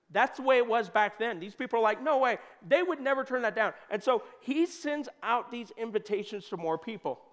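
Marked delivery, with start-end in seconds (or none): 2.0-2.4 emphatic no way. Pretend. People refusing emphatically.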